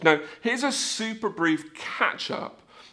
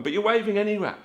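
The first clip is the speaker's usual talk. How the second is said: ordinary talk, nothing marked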